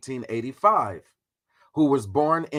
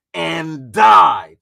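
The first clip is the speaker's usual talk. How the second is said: shouted died; 11 dB louder than usual. Shown as a demonstration. Streamer mocking Du Bois's end, shouting died. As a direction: blast the one word like a punchline. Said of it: "We gotta put the emphasis on that shit".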